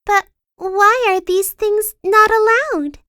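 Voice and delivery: put-on high, cheery girl voice; a curious, sunny lilt up high; bright Little Miss Sunshine. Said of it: "asked Little Miss Sunshine".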